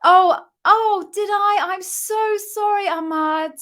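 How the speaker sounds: high, loud "oh" of alarm, gushing sorry; dismayed apology. Real, not acted. Host realising she missed a viewer, startled apology. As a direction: apologise in a startled rush of concern.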